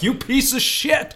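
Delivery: ghostly ancestor scolding from the grave; imitating a furious ancestor; angry Irish ancestor. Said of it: "Irish ancestors are rolling in their graves like".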